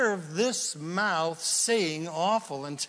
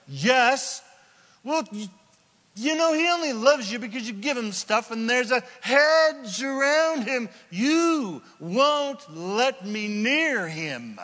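high, loud complaining devil voice; the devil pleads his case, wheedling; a petulant accuser, high and slow at the end; whiny devil; 8 semitones higher, 6 dB louder than usual. Retold or imitated. Devil voiced whining to God.

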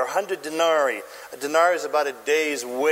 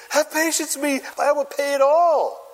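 high-pitched plea for patience; acted begging; 8 semitones higher than usual. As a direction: beg as if fallen at his feet. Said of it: "fell down at his feet and begged him".

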